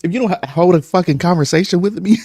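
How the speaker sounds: very high, fast indignant snap; exasperated, can't believe this; mock outrage. To the one-word stranger, high exasperated outrage. Annoyed at short answers.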